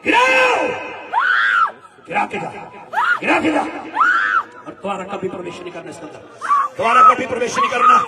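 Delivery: loud high shouts at the spirit; shouted rebuke. Real, not acted. Preacher shouting the demon down, forceful. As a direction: yell it out like a blow.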